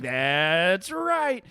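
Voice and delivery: hammed-up catchphrase; imitating Bob. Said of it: "he's like".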